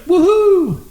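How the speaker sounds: high-pitched re-enacted whoop; retold cheer. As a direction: whoop it as in the garage. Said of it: "And I was like".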